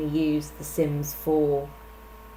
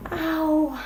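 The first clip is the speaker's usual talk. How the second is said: hit hard crying out; 11 semitones higher than usual. Real, not acted. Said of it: "I just whacked my knee on my table".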